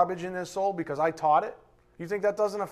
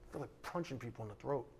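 low, much softer, quick mutter; muttered aside; 6 semitones lower, 18 dB quieter, faster than usual. Real, not acted. Preacher muttering his frustration to himself, quiet and quick. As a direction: mutter it under your breath.

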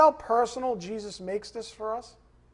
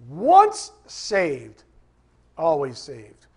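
"once" punched loud; preacher stressing "once" in "once saved"; 3 semitones lower, 7 dB louder, much slower than usual. Real, not acted. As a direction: hit the word "once" hard then drop. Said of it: "Emphasis on once".

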